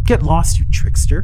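loud shouted dismissal; angry yell. Acted. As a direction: shout him off angrily. Kids yell at trickster.

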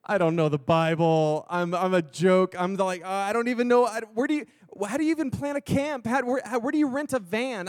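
ramp up into a comic fluster; mock panic. New youth pastor confessing cluelessness to God, rising mock panic.

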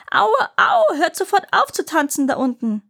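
wail, then order them to stop; elephant howling in pain. Acted. Elephant in pain yelling at dancers.

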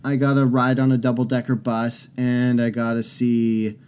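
normal talking voice

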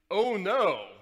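loud mock bellow. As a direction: bellow it out in protest. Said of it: "Ignatius bellowed".

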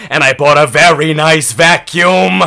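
usual speaking voice

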